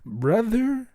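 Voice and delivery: high, loud incredulous call; half-joking outrage; incredulous brother. Real, not acted. Incredulous call to the audience over his wife's verdict. Mock disbelief at wife.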